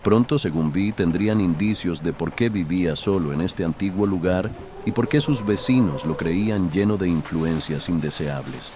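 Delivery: usual speaking voice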